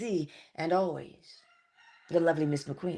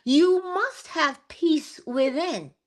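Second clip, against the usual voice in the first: slow emphasis; 8 semitones higher, much slower than usual. Acted. Slow emphatic sermon point.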